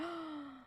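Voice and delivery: soft mock gasp of alarm; quick imitated gasp, quietly drawn in; acted gasp. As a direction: re-enact a small startled gasp. Acts out childhood reaction to balloon.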